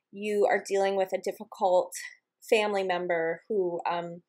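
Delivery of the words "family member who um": normal talking voice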